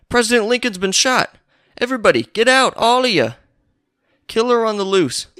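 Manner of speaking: high, loud urgent alarm call; alarmed shout. Retold or imitated. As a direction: clear the room, shouting the news. Man bursts in with alarm.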